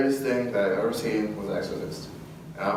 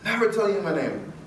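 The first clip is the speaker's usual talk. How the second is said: high, defiant refusal; high defiant jinn voice; 9 semitones higher than usual. Retold or imitated. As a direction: taunt like a stubborn spirit. Jinn refusing to give name.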